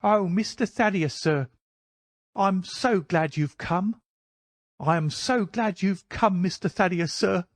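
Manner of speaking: high, effusive welcome; rejoicing old housekeeper. Acted. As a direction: pour out relief in a high old woman's voice. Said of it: "We heard her reiterated rejoicings".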